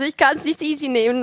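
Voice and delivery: a hint of a huffy man; imitating a sulky husband. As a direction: mimic him refusing to calm down. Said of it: "Und er so".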